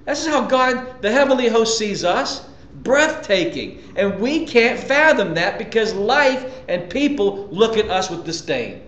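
normal talking voice

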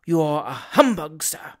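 low, distinct accusation; low, emphatic. A narrator's reading. Gentleman privately rebuking his friend, low and emphatic. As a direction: pronounce it gravely, leaning on the insult. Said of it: "uttered in a low, but distinct and emphatic tone".

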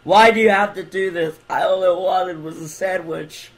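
hammed-up despair; mock despairing wail. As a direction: whine it at the pest. Pleading with game character Timmy.

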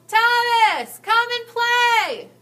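high, loud, drawn-out call across the playground; calling from far away. Acted. Playmate shouting to Thomas from the playground. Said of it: "someone yelled".

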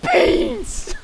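high, giggling cry of delight; real giddy shout; laughing squeal. Real, not acted. Delighted at collecting beans.

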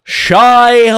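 high, loud, stretched-out worm voice; exaggerated drawn-out call; Jim Carrey. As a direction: belt it out like a manic comic. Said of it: "what would Jim Carrey worm sound like".

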